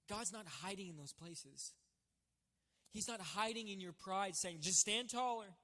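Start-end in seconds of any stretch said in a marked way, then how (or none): 4.6-5.5 mock cheering-on.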